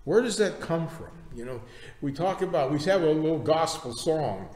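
ordinary delivery

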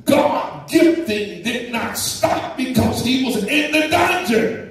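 rising fervent sermon cadence; full-force preaching. Acted. Preacher to congregation, fervent preaching. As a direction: preach it out, building the fire.

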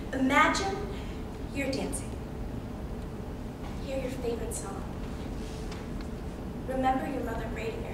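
soft, with long pauses between images; soothing visualization. Acted. Girl guiding herself to memories of her mother, soothing. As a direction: gently lull her into a safe memory.

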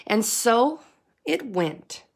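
usual speaking voice

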